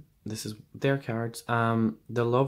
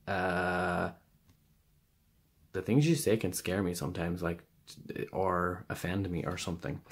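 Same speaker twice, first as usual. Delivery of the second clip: usual speaking voice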